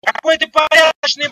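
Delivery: ordinary talk, nothing marked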